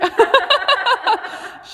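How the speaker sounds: squealing high giggle. Real, not acted. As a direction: burst out giggling at the horse. Horse shows off, she laughs.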